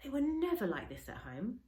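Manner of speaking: ordinary delivery, nothing marked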